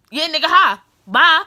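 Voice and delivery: loud mocking goodbye; sarcastic brush-off. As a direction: fling the hi and bye at him.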